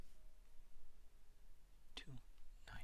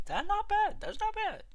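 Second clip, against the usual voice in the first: much higher, cartoonish squeak; squeaky high voice. Streamer pleased with the short's views, in a squeaky high voice. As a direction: pitch it way up like a cartoon. Squeaky repeat of own remark.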